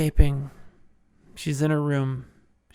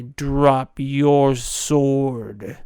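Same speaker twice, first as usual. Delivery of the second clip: slow command; 4 dB louder, much slower than usual. Acted. Wesley commands the prince.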